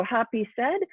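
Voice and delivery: usual speaking voice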